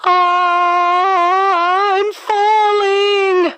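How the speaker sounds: long, drawn-out high shout; high slow wail of a girl dropping down a hole; falling cry. Acted. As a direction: stretch the cry out as she falls. Alice tumbling down rabbit hole.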